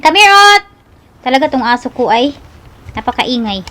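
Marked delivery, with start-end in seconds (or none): 0.0-0.6 high, loud call to come; shouting for the dog to come here. Real, not acted. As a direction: beckon the dog sharply. Calling the dog over.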